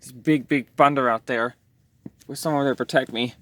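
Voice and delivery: ordinary talk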